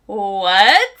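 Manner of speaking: loud stunned what breaking into a laugh; shocked what. Retold or imitated. Daughter hearing the doctor say pregnant, shocked laugh. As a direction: gasp it out in disbelief.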